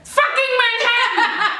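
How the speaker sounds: high, drawn-out cry of disbelief with laughter; exasperated outburst. Real, not acted. Friend exasperated she forgot a borough, laughing outburst. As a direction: burst out at her, half laughing.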